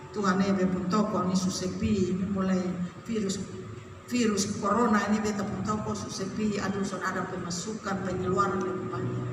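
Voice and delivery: low, subdued lament; complaining congregant. Acted. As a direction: list troubles quietly to God. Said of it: "tentu saudara-saudara akan menjawab".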